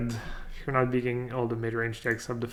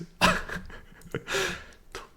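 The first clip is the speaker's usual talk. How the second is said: high, breathless laugh; 6 dB quieter than usual. Real, not acted. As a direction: crack up at your own Irish attempt. Cracks up at own accent.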